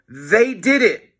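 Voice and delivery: put-on smug kid telling on them; Melvin tattling. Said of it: "Melvin said".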